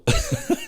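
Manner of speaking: short high chuckle; amused laugh. Real, not acted. Vlogger recalling banter with the lads, amused chuckle. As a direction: let out a quick real giggle.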